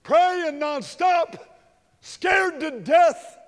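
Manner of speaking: drawn-out shouted climax; slow, loud shout to the crowd. Real, not acted. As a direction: stretch each word and shout it.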